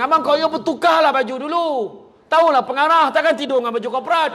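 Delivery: high-pitched nagging; nagging wife. Acted. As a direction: scold husband to change clothes. Wife nagging husband voiced. (isteri membebel kepada suami)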